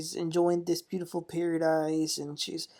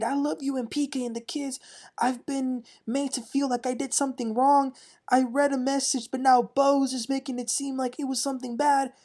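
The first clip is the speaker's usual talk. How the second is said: high-pitched, mildly mock-tearful pleading; Kyle; 6 semitones higher than usual. Kyle pleads with Dorit, mildly mock-crying. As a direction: plead in a raised, put-upon voice. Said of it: "she's screaming and crying, acting like".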